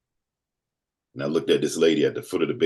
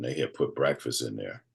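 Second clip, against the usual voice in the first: ordinary delivery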